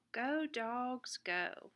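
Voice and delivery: plain reading voice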